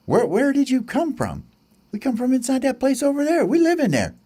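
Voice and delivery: high, quick chick voice; little bird voice. Acted. Birds explain where from.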